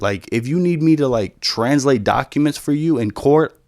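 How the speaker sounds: ordinary delivery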